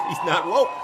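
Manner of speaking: words through a laugh; real laughter. Real, not acted. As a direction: chuckle out the punchline. Repeating punchline while laughing.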